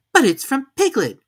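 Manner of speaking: put-on high, eager boy's voice; an excited little boy; Christopher Robin voice. A narrator's reading.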